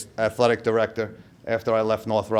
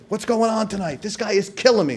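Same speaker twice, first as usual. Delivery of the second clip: high pitched exasperated rant, much higher; exasperated coach; 8 semitones higher than usual. Retold or imitated. Coach venting at his player at halftime, exasperated and high. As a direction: let the frustrated coach vent.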